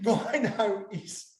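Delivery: ordinary talk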